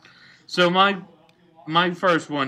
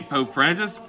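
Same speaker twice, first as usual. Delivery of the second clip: ordinary delivery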